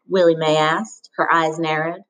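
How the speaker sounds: ordinary delivery, nothing marked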